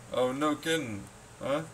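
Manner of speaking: ordinary talk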